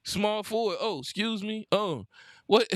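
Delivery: usual speaking voice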